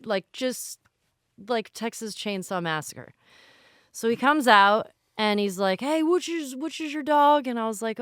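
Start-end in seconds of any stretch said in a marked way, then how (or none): 5.8-7.5 lightly imitating a big Southern guy; Southern accent. Crematorium worker greets grieving owner in a lightly Southern accent. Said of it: "He had a Southern accent".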